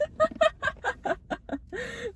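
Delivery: squealing laugh; high giggle fit. Real, not acted. Laughing at awkward situation.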